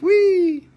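high squealed whee; gleeful whee. Pretend. Host squeals with glee over a pretty stone. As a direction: squeal it out like a delighted kid.

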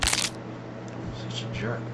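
slow whispered grumble. Real, not acted. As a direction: breathe it out bitterly. Muttering about prankster.

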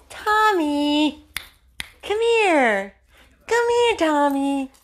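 high-pitched call to a pet; calling the dog. Real, not acted. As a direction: summon a dog in a very high, coaxing voice.

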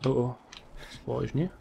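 normal talking voice

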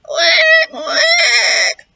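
very high, loud crying quacks; wailing duck quacks. Acted. Hurt duck wailing at mouse's door, shouted quacks. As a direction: wail the quacks like a sobbing duck.

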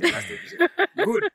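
laughing it out, high and loud. Real, not acted. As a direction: burst into laughter on the words. Laughing at the reply.

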